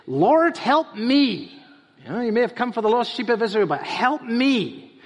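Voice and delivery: lightly voiced pleading Canaanite woman. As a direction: beg like a desperate mother. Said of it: "She says".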